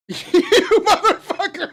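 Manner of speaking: high, loud laughing yell at a friend; laughing shout. Real, not acted. Friend teasing him, yells it laughing and loud. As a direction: shriek it out through laughter.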